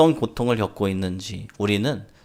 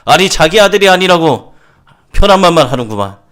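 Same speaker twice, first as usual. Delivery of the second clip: very loud, raised-pitch retort; heated angry father; 8 semitones higher, 13 dB louder than usual. Acted. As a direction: throw it back at him, fuming loudly. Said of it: "이 아버지가 또 열받아서". (열받아서 버럭 쏘아붙이듯)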